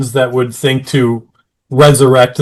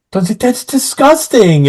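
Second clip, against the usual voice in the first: disgusted Dawn; 9 semitones higher than usual. Retold or imitated. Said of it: "Dawn's like".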